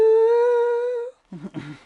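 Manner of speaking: high loud laughing burst; burst of laughter. Real, not acted. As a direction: cracks up laughing. Laughs after his song.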